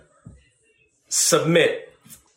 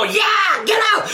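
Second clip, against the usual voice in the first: higher, hammed-up yell; mock zealous shout; 8 semitones higher, slower than usual. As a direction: lampoon the hyped-up shouter. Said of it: "That's that storefront church stuff".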